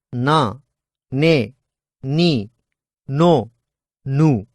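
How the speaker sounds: na, ne, ni, no, nu drawn out, each given equal length; each syllable long and even; slow demo syllables. Shown as a demonstration. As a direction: prolong na, ne, ni, no, nu one by one. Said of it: "slowly repeat the following sounds".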